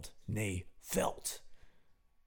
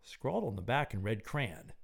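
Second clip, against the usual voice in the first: usual speaking voice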